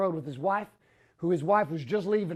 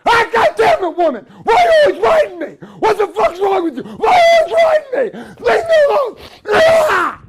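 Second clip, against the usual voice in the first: much higher, much louder furious screaming; freaking out; 13 semitones higher, 12 dB louder than usual. Retold or imitated. Husband screaming at wife over a bill question, full rage. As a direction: lose it completely at your wife. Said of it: "I freak out".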